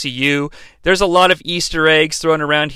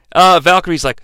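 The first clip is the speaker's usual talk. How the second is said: ordinary delivery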